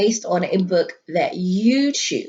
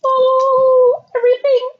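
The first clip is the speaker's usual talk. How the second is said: high, loud anxious cry; nervous; 9 semitones higher, 4 dB louder than usual. A narrator's reading. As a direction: fret over the outcome, fast and high. Narrator acts nervous suspense.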